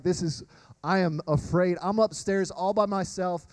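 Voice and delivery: normal talking voice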